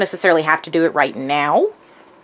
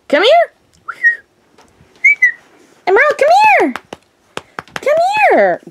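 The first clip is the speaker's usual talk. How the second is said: high, loud call to a pet; 6 dB louder than usual. Real, not acted. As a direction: summon the dog from afar. Calling the dog over.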